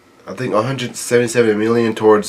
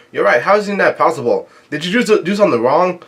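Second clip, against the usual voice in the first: mock yell; 7 semitones higher than usual. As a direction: call out in disbelief, without full volume. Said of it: "one of the Straw Hats yell out".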